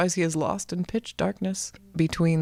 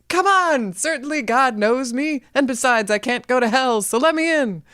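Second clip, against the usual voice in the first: much higher, louder begging; pleading Jack; 8 semitones higher, 4 dB louder than usual. A narrator's reading. Jack begging Saint Peter to let him in, high whine. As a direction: whine your way past the gatekeeper. Jack begs at pearly gates.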